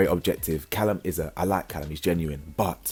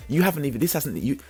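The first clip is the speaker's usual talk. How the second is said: ordinary delivery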